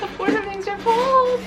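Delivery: high, loud, hammed-up accusation; theatrical mock outburst; mock wailing blame. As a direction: playfully wail it up high at mom. Mock blaming of mom, repeated louder.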